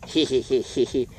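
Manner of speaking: low, mimicked "hee hee hee"; imitated taunting laugh. Boy riding off on stolen bicycle, imitated mocking laugh. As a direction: mimic a kid's sneaky snicker. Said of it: "he was laughing at me".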